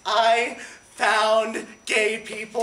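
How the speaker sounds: slow loud joyful exclamation; joyful shout. Acted. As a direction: announce it with delight. Joyful discovery.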